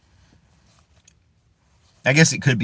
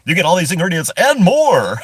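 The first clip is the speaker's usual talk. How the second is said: laughing mock ad read, louder and higher; commercial voice; 6 semitones higher, 5 dB louder than usual. Vlogger spoofing a chip-bag ad, laughing announcer voice. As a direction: sell it like a cheesy commercial. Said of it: "a little commercial for that".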